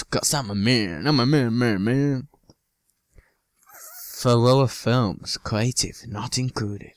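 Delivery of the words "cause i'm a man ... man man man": ordinary delivery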